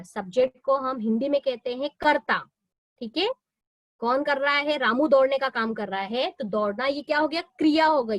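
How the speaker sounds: ordinary talk, nothing marked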